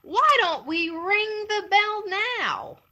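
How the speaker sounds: high, slow eager plea; eager coyote. Acted.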